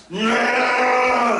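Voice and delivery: loud, high mock "nah" bleat; online complainer's whine. People complaining online about the remake, mock wail. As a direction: imitate an online moaner's long drawn-out cry. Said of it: "That's what they sound like when they complain online".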